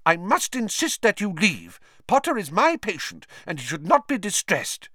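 high, firm, indignant; insist, bristling with anger; angry nurse. Acted. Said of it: "said Madame Pumphrey angrily".

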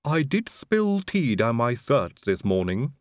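slow, lisping servant drawl; a creepy, lisping manservant; Igor's voice. A narrator's reading. Igor answers Sybil.

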